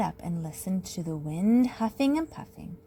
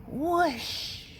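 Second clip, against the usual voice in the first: usual speaking voice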